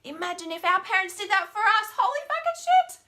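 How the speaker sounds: high, loud, worked-up exclaiming; passionate outburst. Real, not acted. Speaker exclaiming about what parents never did, loud and high. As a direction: burst out with real passion. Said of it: "so damn passionate about it".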